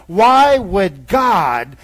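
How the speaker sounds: slow, loud, stretched rhetorical why; drawn-out why. Acted. Pastor posing the gospel's mystery, slow and loud.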